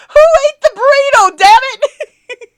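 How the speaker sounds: high, loud crying mock shout; mock yell. Streamer mocking a chat reaction, loud mock wail. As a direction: wail it at the top of your voice. Mock outburst about a burrito.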